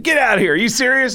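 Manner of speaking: high, loud and amazed; excited disbelief. Retold or imitated. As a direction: react like you can't believe your luck. Meets a guy who's his fan.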